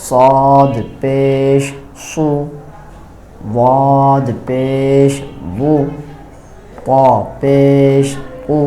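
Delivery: drawn-out teacher recitation of letters; slow letter drill. Shown as a demonstration. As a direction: chant each letter slowly for students.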